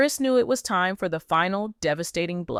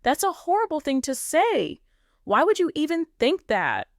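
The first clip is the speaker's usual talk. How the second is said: defensive; 9 semitones higher than usual. Acted. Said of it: "immediately went on the defensive".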